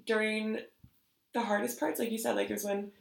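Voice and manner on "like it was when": normal talking voice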